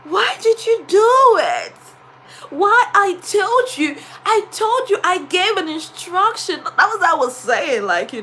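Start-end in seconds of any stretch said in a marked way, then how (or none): 0.0-6.6 angry teacher. Shown as a demonstration. Teacher scolding the boy who broke her phone, angrily. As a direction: demand answers from the pupil, furious. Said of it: "Look at someone that is angry".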